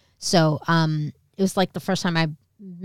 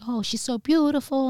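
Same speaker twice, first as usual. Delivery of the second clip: higher-pitched mock gushing; fawning; 7 semitones higher than usual. Family admires the long-lost girl, fawning. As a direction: coo admiringly in an imitated voice. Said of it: "fawning over me".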